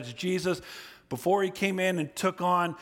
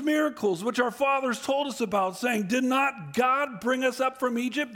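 higher, aggrieved questioning voice; lamenting Gideon; 7 semitones higher than usual. Acted. Gideon questioning the Lord, higher and aggrieved. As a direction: complain to God like a man who feels abandoned.

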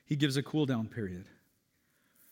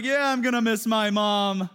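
higher, louder mock crying child; imitating a bawling kid; 6 semitones higher, 6 dB louder than usual. Pastor retelling a schoolboy crying for his mom, mock sob voice. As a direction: play the little boy breaking into tears. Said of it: "And then he just starts bawling".

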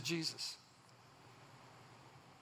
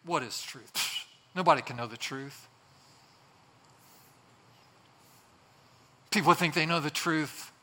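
dismissive scoff with pffts and pauses; sarcastic, cynical. Acted. Pilate scoffs at those who know truth, cynical. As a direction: wave it all away with weary cynicism. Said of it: "sarcastically, cynically".

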